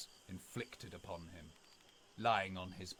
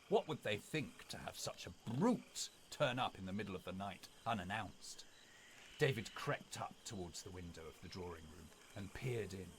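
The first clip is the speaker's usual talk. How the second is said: plain reading voice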